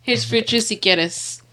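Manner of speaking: soft, slow whisper; ASMR whisper. Pretend. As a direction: whisper it close to the mic. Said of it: "That A S M R, honey".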